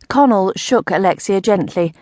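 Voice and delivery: usual speaking voice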